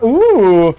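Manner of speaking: ordinary talk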